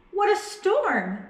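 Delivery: high, chirpy bird voice; twittering wren. Acted. Wren chatters about the storm, high and chirpy. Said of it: "twitters wren".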